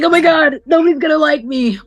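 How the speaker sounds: mock panic about being liked; panicky. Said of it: "I was really shy".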